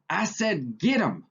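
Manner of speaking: exasperated shout; yelling again. A narrator's reading. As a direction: repeat the order with force. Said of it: "George yelled again".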